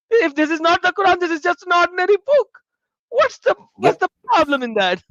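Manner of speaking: high, loud, fast feigned-innocent question; mock innocent retort. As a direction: play it sly, all wide-eyed innocence.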